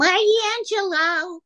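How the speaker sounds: high, drawn-out cutesy American call; high-pitched gangster's moll voice, very slow. Shown as a demonstration. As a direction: stretch it slowly, high and cute. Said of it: "an American gangster's mole".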